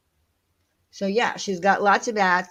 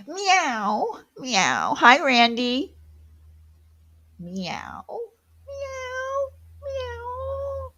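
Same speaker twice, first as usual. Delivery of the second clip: high squeaky meow like a kitten; playful kitten meows; cat meows; 13 semitones higher, much slower than usual. Pretend. Meowing at a cat.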